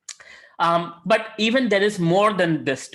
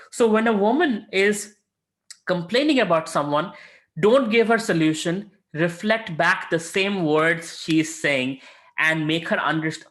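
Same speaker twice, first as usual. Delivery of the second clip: normal talking voice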